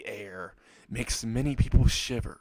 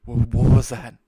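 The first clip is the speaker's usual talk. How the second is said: much louder startled question; alarmed; 11 dB louder than usual. Acted. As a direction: blurt it out in sudden alarm, stumbling on the first word. Woken suddenly, repeats question in alarm.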